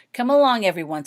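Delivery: brisk, loud call to hurry everyone along; a loud, businesslike rally of the group. A narrator's reading. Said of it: "said Jenny briskly".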